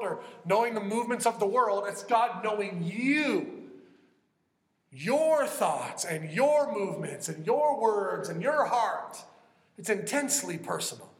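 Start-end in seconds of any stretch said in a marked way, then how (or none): 1.9-9.2 "you" and each "your" stretched out slowly; slow, emphatic list hammering "your"; stress on you. Real, not acted. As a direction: punch every "your", drawing it out. Preacher stresses God's personal knowing.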